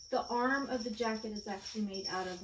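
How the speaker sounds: ordinary talk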